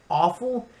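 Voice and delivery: ordinary talk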